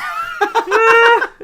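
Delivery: a sudden high, loud cackle of laughter; loud burst of laughter. Real, not acted. Reacting to the joke about Jim's aunt, with a loud high burst of laughter. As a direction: crack up out loud.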